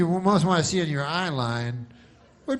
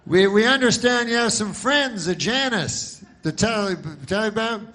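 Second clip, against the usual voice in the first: mock anchor; 8 semitones higher, 4 dB louder than usual. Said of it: "And they go".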